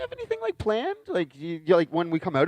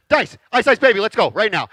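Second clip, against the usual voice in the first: 1 excited shout; 8 semitones higher, 11 dB louder than usual. Retold or imitated. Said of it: "he's like".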